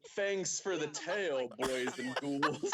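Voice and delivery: slow, drawn-out ghoulish host voice; a light, hammy Crypt Keeper. Acted. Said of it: "that's my Crypt Keeper".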